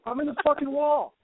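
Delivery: very high-pitched comic retort; exasperated mock cry. Trapped woman answering passer-by, high mock exasperation. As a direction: cry back in a squeaky put-on voice.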